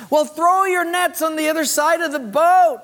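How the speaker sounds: high-pitched call across water. Acted. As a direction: call the instruction out to fishermen offshore. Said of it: "he calls out to the disciples".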